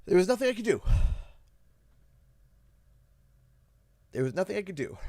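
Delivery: high, helpless grief; anguished. Real, not acted. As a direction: pitch lifting as you fight back tears. Upset over leaving the dog.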